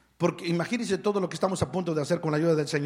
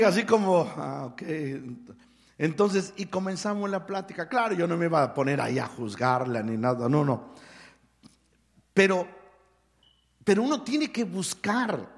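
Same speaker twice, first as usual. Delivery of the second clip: ordinary talk, nothing marked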